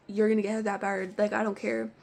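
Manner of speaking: ordinary delivery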